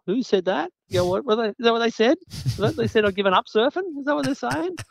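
high, fast, puzzled questioning voice; mock bewildered Michael. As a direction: play him baffled by what others said. Mimicking Michael puzzled by rumours.

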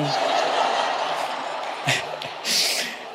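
quiet breathy giggling; embarrassed laugh. Real, not acted. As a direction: laugh it off softly. Embarrassed laugh mid-reading.

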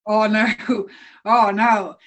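half-laughing oh no as it sinks in; laughing dread. Retold or imitated. On getting the hiking license, laughing mock dread. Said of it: "And it was like".

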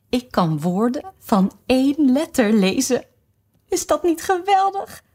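high, delighted little queen voice. Acted. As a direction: boast like a dotty queen. (trots, hoog stemmetje)

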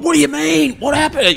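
mock oblivious denial. Friend imitating the comic denying his breakdown, high and clueless. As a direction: play dumb in a high voice.

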